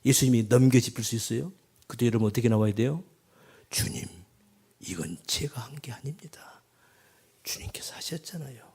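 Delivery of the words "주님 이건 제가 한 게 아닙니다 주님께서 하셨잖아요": soft whispered confession; humble whisper. Acted. Believer answering the Lord, humble hushed whisper.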